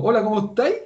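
singsong Chilean greeting; Chilean rising intonation. Shown as a demonstration. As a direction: lift the ending, Chilean style. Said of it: "en Chile subimos".

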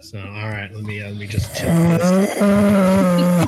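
leaning into the mic. Real, not acted. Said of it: "That did not sound relaxing".

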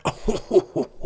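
high, drawn-out hooting laugh; high "oh-ho-ho" of amusement; amused laugh. Real, not acted. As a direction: hoot with laughter at the image. Laughs at the man described.

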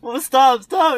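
put-on high, strangled, panicky kid's voice; squealing kid. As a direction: play the kid gasping while held up by the shirt. Acting out a choked kid.

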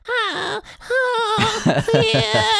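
very high squealing yelps, loud at the end; annoying squeal. Shown as a demonstration. Squealing over voice chat to annoy rival players. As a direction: squeal to wind up opponents. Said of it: "make it annoying sounds".

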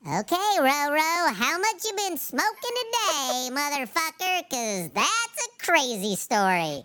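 mock skeptic through a voice changer. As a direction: run it through a silly voice filter. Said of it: "I got something for that".

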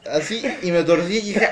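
ordinary talk, nothing marked

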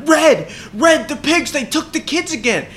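high loud frantic report; hammed-up panic; urgent alarm. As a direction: rush to warn Red. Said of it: "and they're like".

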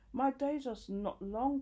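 high-pitched moan. A narrator's reading. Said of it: "Kathy moaned".

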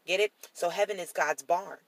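normal talking voice

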